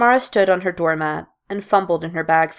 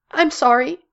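choked-out apology; 9 semitones higher, 4 dB louder than usual. Acted. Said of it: "Mara managed to choke out".

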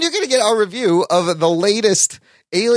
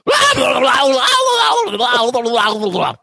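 spazzing out shout; 7 semitones higher than usual. Retold or imitated. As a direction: wail like someone losing it completely. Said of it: "you sound like this".

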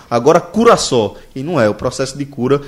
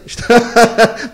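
1 burst of laughter; 9 semitones higher, 4 dB louder than usual. Real, not acted. Laughing at friend's routine.

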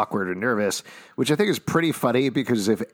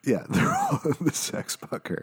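laughing; 6 semitones higher, 4 dB quieter than usual. Real, not acted. Echoing the sex bunker joke.

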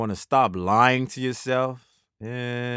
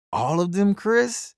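incredulous; 11 semitones higher than usual. Real, not acted. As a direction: pitch jumping up in disbelief. Disbelief at the destroyed jerseys.

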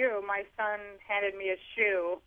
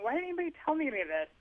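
exasperated inner question; 8 semitones higher, much faster than usual. Retold or imitated. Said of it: "I kept thinking".

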